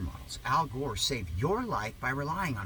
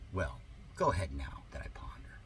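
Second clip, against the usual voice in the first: smug little whisper; whispered aside; 8 semitones lower, 7 dB quieter than usual. Acted. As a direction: slip it in under your breath.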